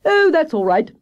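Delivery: high, loud, carefree reply; breezy hare character; Hare, breezy and confident. Acted. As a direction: brush it off loudly, pitched high.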